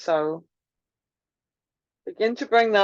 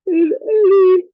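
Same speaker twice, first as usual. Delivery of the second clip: words stretched through a big deliberate yawn; talking mid-yawn to invite a yawn; 8 semitones higher, 8 dB louder than usual. Shown as a demonstration. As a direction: yawn wide as you say it. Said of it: "my yawn was deliberate".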